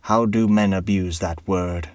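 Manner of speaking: slow, a touch of scorn in the dwarf's voice; lightly voiced dwarf scoffing at honor; scornful dwarf. A narrator's reading. Dwarf scoffs at the word honor, slow and scornful. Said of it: "cried the dwarf".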